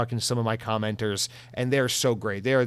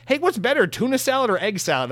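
mock cheerful fan; 10 semitones higher, 4 dB louder than usual. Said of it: "audience is more like".

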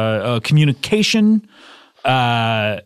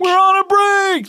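high, hammed-up sitcom catchphrase; Ross. Host echoing the Friends line, exaggerated Ross impression. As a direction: cry it out high and indignant. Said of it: "that was actually a really good, uh, Ross impression".

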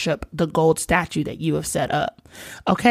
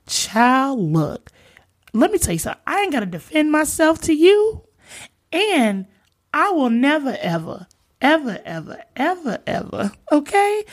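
sassy retelling; 6 semitones higher than usual. Retold or imitated. Sassy modern retelling of defiance.

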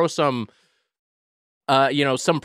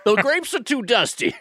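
put-on high old-timer prospector voice; cranky gold-rush old-timer; 11 semitones higher than usual. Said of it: "This was an old prospector?".

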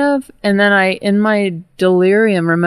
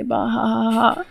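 deadpan laugh; 4 semitones higher, 5 dB quieter, faster than usual. Retold or imitated. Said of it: "a pretty good comeback to say".

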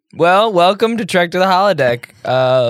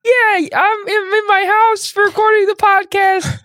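fake Jan voice. Cohost fakes absent Jan's voice for a joke, high put-on voice. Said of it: "Jan, you don't sound like that".

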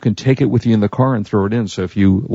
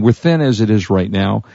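ordinary talk

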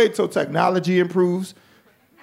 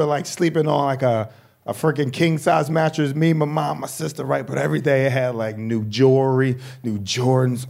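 ordinary talk

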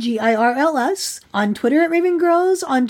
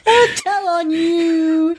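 put-on childish threat; 4 semitones higher, much slower than usual. Sibling squabble parody.

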